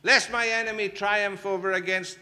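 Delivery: high, loud desperate plea to God; pleading David. Acted. David arguing with God for help, loud desperate pleading. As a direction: beg God like David, loudly. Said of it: "that's what David does".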